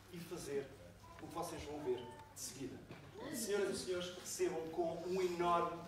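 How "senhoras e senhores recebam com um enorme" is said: grand entrance announcement. Real, not acted. Host introduces the cast on stage.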